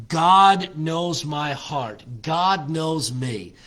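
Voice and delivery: slow, loud preaching proclamation; emphatic declaration. Acted. Preacher voices Job insisting God knows him, slow emphatic declaration. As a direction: draw out each word with conviction.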